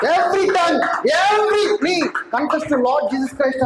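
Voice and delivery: slow booming pulpit shout; proclaiming shout. Acted. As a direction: thunder it out slowly like a decree. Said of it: "అన్నాడు".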